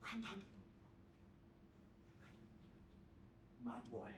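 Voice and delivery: very soft, mostly low voice; hushed. Acted. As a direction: speak low, as in a private memory. Hushed exchange voiced with grandad.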